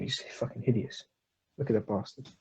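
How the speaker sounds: normal talking voice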